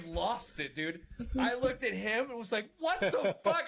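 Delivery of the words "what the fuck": high, loud incredulous outburst through laughter; laughing disbelief. Retold or imitated. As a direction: blurt it out, losing it laughing. Retold disbelief, cracking up laughing.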